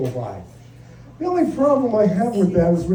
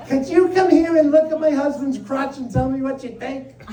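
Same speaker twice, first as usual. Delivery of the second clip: higher mock wife voice; wife's voice with a laugh in it; wife's request; 6 semitones higher than usual. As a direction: play the wife asking sweetly.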